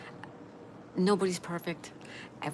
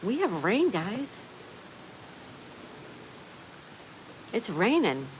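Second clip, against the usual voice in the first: excited announcement; 4 semitones higher, slower than usual. Real, not acted. Streamer telling guys it's raining, excited. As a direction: call it out to everyone, real excitement. Streamer exclaims to viewers about rain.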